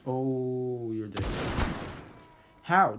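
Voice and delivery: usual speaking voice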